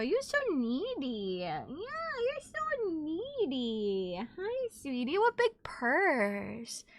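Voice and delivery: soft, drawn-out cooing; cooing pet talk. Real, not acted. Fussing over someone needy, soft drawn-out coo. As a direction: coo slowly and softly, stretching the words.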